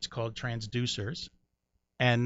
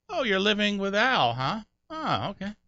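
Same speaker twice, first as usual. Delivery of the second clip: knowing tease; 8 semitones higher than usual. Retold or imitated. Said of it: "they're like".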